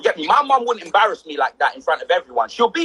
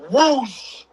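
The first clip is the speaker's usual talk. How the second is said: a quick airy whoosh of a slap; whoosh sound effect; 5 semitones higher than usual. Retold or imitated. Retelling his mum's slap, a whoosh effect. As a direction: swipe the air with your voice. Slap swooshing through the air.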